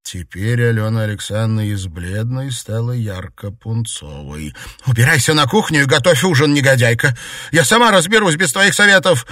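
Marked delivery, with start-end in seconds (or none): 4.9-9.3 high, very loud outburst; furious yelling. A narrator's reading. Mistress banishing maid to the kitchen, furious. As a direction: explode at the insolent maid.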